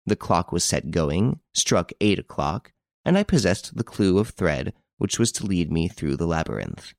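usual speaking voice

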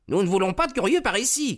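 high, rapid warning cry from a groom. A narrator's reading. Groom turns away onlookers at the stables, crying out high and fast. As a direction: call out fast, pitch shooting right up. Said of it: "cria-t-il".

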